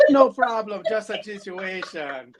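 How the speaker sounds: wife's hammy fake Jamaican accent, high and loud; overdone Jamaican lilt, drawn out at the end; bad Jamaican accent. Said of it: "uses her worst Jamaican accent".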